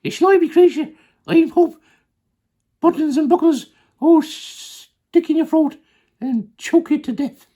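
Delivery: very high, loud monkey outcry; Mugglewump. Acted. Monkey insulting the crocodile, very high and loud. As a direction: shriek the insult in a squeaky voice. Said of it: "cried Mugglewump".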